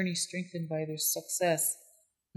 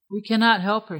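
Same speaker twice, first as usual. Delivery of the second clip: louder, higher dismissive refusal; dismissive sniff; 4 semitones higher, 7 dB louder than usual. A narrator's reading. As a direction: brush off the old coyote's plea. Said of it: "sniffed Left Hand".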